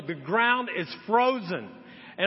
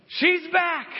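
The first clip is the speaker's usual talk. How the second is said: a high, louder excited announcement; excited news; 10 semitones higher, 4 dB louder than usual. Retold or imitated. Friends on the phone about the revived hamster, excited. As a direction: burst out with the good news.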